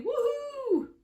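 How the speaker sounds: high, drawn-out woohoo; high playful cheer; whooping ladybird. Acted. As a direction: whoop like a tiny delighted creature. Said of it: "there's a little ladybird saying".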